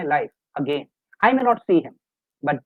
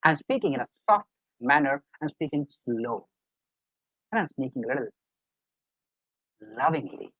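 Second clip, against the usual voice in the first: soft, loving tone; 3 semitones lower, 3 dB quieter than usual. Shown as a demonstration. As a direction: ease down into a gentle, loving voice. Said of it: "Like you're seeing the tone just now".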